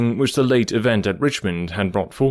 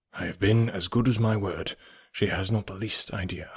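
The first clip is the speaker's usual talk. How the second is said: quiet aside, much softer; lower tone; 3 semitones lower, 6 dB quieter than usual. Acted. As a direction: drop the voice, a private aside. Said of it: "in a lower tone".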